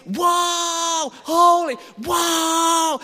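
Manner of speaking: high loud gasping wows; amazed shouts. Shown as a demonstration. Showing what holy means, amazed loud shouts. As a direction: gape and shout in wonder. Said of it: "holy just means".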